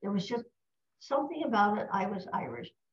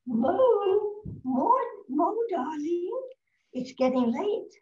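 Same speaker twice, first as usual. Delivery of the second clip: Stooge's wife calling; 9 semitones higher, 4 dB louder, slower than usual. Retold or imitated. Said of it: "you would hear one of them say".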